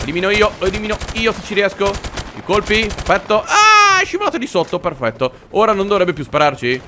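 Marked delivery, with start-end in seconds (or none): 3.4-4.0 loud, very high cry; startled scream. Real, not acted. Player startled by an enemy, sharp scream. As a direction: scream out in surprise.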